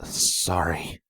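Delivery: soft, shaky apology; quavering voice. A narrator's reading. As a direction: let the voice waver. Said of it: "my quavering voice managed".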